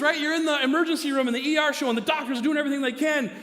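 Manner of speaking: normal talking voice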